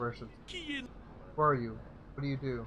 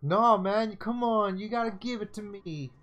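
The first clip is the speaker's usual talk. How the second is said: high, loud whiny pleading; mock begging; 7 semitones higher, 5 dB louder, slower than usual. Player mocking his character's begging, high whiny plea. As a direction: beg like a desperate kid. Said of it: "like, begging".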